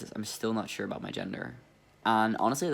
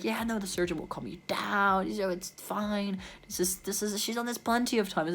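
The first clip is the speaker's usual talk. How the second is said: high, sing-song cheer to calm a patient; chirpy assistant; 9 semitones higher than usual. Retold or imitated. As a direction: do the sweet, upbeat nurse on the phone. Assistant calming the nervous patient.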